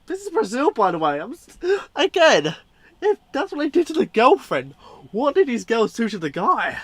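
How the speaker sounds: high, loud disbelieving outburst; shocked outburst. Real, not acted. Outraged at gory clip.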